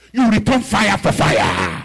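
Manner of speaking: loud, slow, emphatic order; preaching command. Real, not acted. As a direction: hammer the point home.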